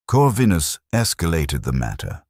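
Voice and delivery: plain reading voice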